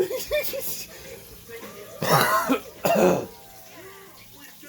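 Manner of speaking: breathless wheezing laughter; can't stop laughing; hysterical laugh. Real, not acted. Laughing at the show.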